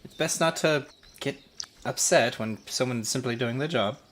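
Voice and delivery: high, measured NPC official; guard captain voice. Acted. Official tells travelers to calm down, high and measured. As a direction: calmly lecture the travelers as an official.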